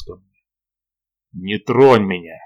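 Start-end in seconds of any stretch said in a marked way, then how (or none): 1.3-2.4 slow, louder hoarse command; hoarse croak. A narrator's reading. Wounded lord warning Ilona off as she reaches for him, hoarse. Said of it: "прохрипел я".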